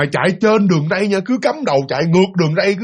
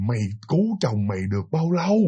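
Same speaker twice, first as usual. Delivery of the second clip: contemptuous ghost; 7 semitones lower, 7 dB quieter than usual. Retold or imitated. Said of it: "nói mà khinh khỉnh mà khinh bỉ".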